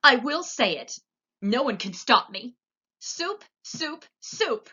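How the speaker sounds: high, loud, stubborn outburst; high, loud defiant outcry; defiant shout. A narrator's reading. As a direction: insist at the top of the voice. Cook defies Louise, shouting.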